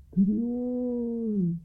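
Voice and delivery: high, very slow fading wail; mournful ghostly cry. A narrator's reading. Ghostly fisherman's cry fading into the west, mournful and slow. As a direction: let it drift away like a ghost. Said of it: "lingered the mournful cry".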